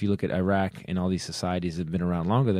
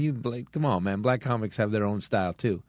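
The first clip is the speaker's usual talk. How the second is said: ordinary delivery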